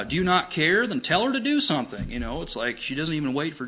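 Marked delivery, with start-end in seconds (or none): 0.0-2.1 pitched up, louder, demanding; impatient demand. Acted. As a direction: push it like an impatient demand that won't wait. Re-voicing Martha's impatient demand.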